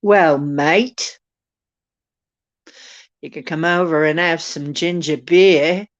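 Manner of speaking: put-on loud, matey Aussie accent; hearty put-on Australian invitation. Inviting a mate for ginger beer, Aussie accent. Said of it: "you like my Aussie accent?".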